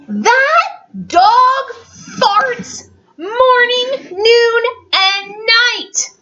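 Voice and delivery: high, slow mock complaint with a laugh; sing-song grumble about the dog; exasperated father. Parent voicing father griping about the farting dog. Father quote in read story.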